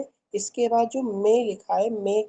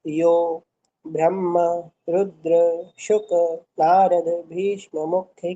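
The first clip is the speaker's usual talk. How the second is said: each word slowly and separately; broken into pieces; 3 semitones lower, slower than usual. Shown as a demonstration. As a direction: read word by word, pausing between. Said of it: "फिर से देखिए".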